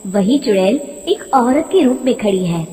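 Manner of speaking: ordinary delivery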